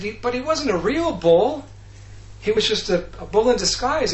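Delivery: high, loud mock protest; hammed-up defensive objection, pitched well up. Voices Krishna protesting to the gopis.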